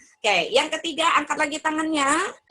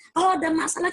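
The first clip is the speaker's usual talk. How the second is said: normal talking voice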